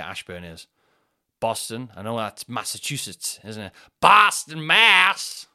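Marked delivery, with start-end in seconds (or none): 4.0-4.6 loud, higher American drawl on "Boston"; "Boston" in a big mock American accent; hammed-up American accent. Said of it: "Same accent". 4.6-5.5 put-on loud, higher American accent reading place names; a playful put-on American accent; mock American accent. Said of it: "Same accent".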